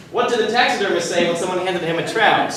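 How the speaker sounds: ordinary delivery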